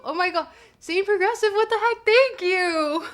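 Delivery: high, loud, astonished gush; can't believe the gift; excited disbelief. Real, not acted. Shocked by a big donation.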